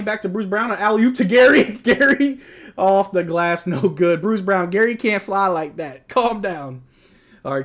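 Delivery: fired-up broadcast call; excited play-by-play. Acted. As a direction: shout the action like a hyped commentator. Calling the game live.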